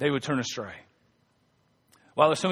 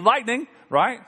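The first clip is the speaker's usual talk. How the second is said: ordinary talk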